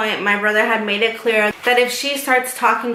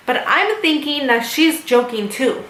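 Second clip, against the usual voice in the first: ordinary talk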